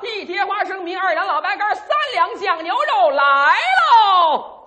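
very high, sing-song holler to the kitchen; old-style waiter's long ringing cry; waiter's order call. Pretend. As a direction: chant the order out, stretching the last word. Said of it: "全得大声的交流".

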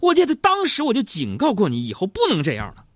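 mock-furious high, loud tirade; yelling at wife. Husband scolding wife over buying cosmetics, mock-angry yelling. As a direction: roar like a husband laying down the law, hammed up. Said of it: "你就得像我这么吼他".

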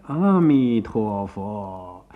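低沉缓慢地拖长念佛号; 像老头诵佛一样慢慢念; 念佛的老头. A narrator's reading. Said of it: "一路念着". (低声慢诵佛号)

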